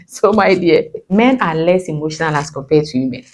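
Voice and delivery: ordinary talk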